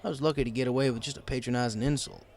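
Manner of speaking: plain reading voice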